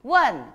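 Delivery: 원 struck loud, falling fast; fourth tone. Shown as a demonstration. Teacher models fourth tone for students, 원 loud and falling. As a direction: hit 원 hard and drop the pitch. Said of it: "사성으로 해보세요".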